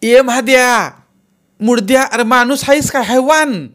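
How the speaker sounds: high, loud furious shout. A narrator's reading. Aunt yelling at the cart driver, loud furious shout. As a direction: scream it across the carts. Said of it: "ओरडलीच".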